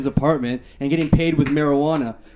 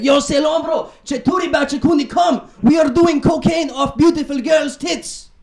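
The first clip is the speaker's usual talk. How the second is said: put-on much higher, louder, shouted bro accent; Persian bro voice; 11 semitones higher, 3 dB louder, slower than usual. Persian friends calling [name] to party, high shouted bro accent. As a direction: hype it up like an excited party bro on the phone. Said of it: "they would call me and be like".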